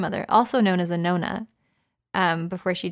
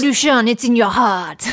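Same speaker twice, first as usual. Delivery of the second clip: hammed-up French actress, louder; French accent; 3 semitones higher, 7 dB louder than usual. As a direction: keep the mock French going. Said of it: "play a French actress".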